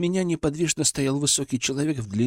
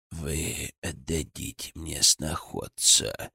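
low, slow, sure of himself; confident villain; 8 semitones lower, 7 dB quieter, much slower than usual. A narrator's reading. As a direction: state it as settled fact. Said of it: "уверенно заявил Градов". (уверенно, низким глухим голосом)

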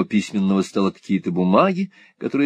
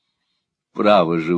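usual speaking voice

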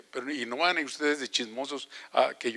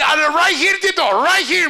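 put-on high, loud child's voice pointing the way; little girl in English; 12 dB louder, slower than usual. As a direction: call out like an eager four-year-old. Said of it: "me decía en inglés".

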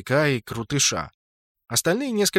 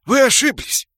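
high, loud denial; panicked orc; 11 semitones higher, 8 dB louder than usual. A narrator's reading. Orc insists they have the wrong person, panicked. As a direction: cry out a frantic denial.

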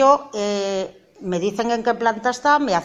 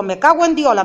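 loud, high angry curse; shouted curse; 6 semitones higher, 8 dB louder than usual. Retold or imitated. Mother shouting a curse back at her son. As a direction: spit the curse out at full voice. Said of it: "Entonces yo le grito también a él".

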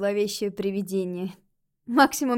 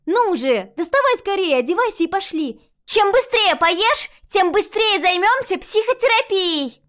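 put-on high, loud urgent girl; child ghost voice; 9 semitones higher, 8 dB louder than usual. A narrator's reading. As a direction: hurry her along eagerly.

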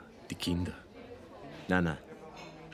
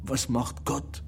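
slow brooding; 5 semitones higher, much slower than usual. Acted.

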